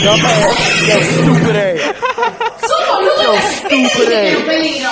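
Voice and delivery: loud laughing yell. Real, not acted. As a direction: shout it at the screen. Yelling at game screen.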